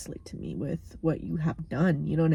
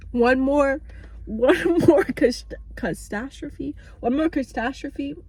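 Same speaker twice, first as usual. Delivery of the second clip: higher and louder, fed-up cry; exasperated outburst; 7 semitones higher, 3 dB louder, slower than usual. Real, not acted. Fed-up vlogger venting about being stuck at home, loud outburst. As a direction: throw it out, at the end of her rope. Ranting about her situation.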